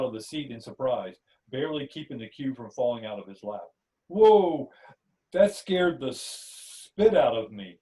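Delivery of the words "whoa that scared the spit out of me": jolted, loud startled whoa; startled gasp. Acted. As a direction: jump out of your skin, then slow down. Startled by the appearing cube.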